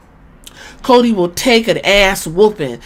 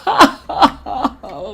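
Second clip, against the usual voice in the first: shouted laugh; 4 semitones higher than usual. Real, not acted. Reacting to his champion losing.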